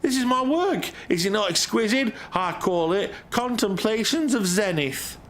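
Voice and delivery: high character voice. Acted. Streamer voicing NPC in game.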